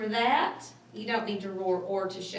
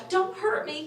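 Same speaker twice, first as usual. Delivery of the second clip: scared, higher plea; whimpering lion; 9 semitones higher than usual. Acted. Frightened lion begging the mouse, whimpering. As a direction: beg not to be hurt, trembling. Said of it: "he whimpered".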